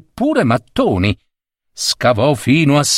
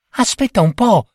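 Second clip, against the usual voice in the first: wife's voice; 11 semitones higher than usual. Acted. Wife speaking.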